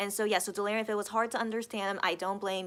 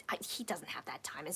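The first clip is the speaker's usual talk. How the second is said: softly, under your breath; whispered aside; 4 semitones lower, 10 dB quieter than usual. Real, not acted. Aside about his busy schedule.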